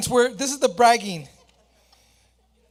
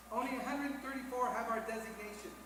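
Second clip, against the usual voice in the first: ordinary talk, nothing marked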